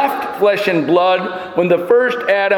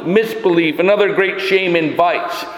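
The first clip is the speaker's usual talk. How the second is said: ordinary talk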